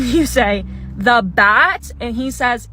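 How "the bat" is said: loud, rising disbelief; startled question. Retold or imitated. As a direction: blurt it out as an incredulous question. Acted startled reply to warning.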